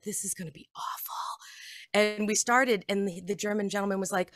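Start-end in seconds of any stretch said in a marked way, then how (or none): 0.0-1.1 a hushed, panicked whisper to herself. Retold or imitated. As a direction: breathe it out in dread, no voice. Retold panicked inner thought.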